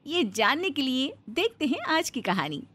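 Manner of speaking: ordinary delivery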